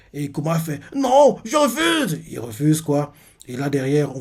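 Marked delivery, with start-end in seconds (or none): 0.9-2.2 put-on defiant child. Kuma refusing.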